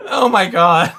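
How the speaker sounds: loud laughing exclaim. Real, not acted. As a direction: burst out laughing in disbelief.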